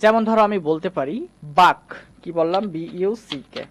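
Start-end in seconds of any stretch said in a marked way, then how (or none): 1.6-1.8 "বাক" pronounced "baak", not "book"; BUCK with "a" vowel. Shown as a demonstration. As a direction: say "বাক" with an open a. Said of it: "তাই আ উচ্চারণ করব".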